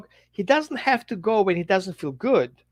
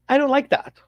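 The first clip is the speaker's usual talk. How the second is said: firm objection; 9 semitones higher, 5 dB louder than usual. Retold or imitated. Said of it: "then you can tell your dog".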